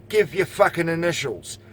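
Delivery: ordinary delivery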